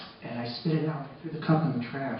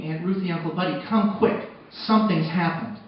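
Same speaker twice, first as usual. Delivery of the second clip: retold scream; 2 semitones higher, 7 dB louder than usual. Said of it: "and I scream".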